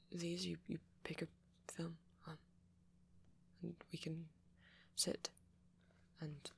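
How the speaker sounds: hesitant, stammering speech with long pauses; shy, flustered date. Acted. Flustered host welcoming a date to movie night, shy and halting. Acted shy roleplay, then plain outro.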